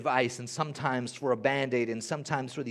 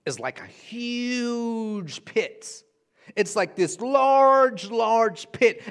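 slow, loud, high stretched words; preacher painting how big the pit is; drawn-out emphasis; 8 semitones higher, 7 dB louder, much slower than usual. Real, not acted. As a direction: stretch each word to show how huge.

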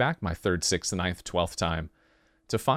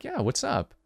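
light, higher acted reply; casual partner; 7 semitones higher, 4 dB quieter than usual. Partner answering the lover's question, light and casual.

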